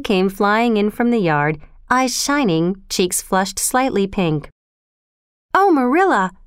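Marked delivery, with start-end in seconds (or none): 5.5-6.3 put-on high, eager girlish exclamation; breathless excitement. A narrator's reading. Anne runs in to Marilla with news, breathlessly excited. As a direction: burst out with happy news. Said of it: "Anne said breathlessly".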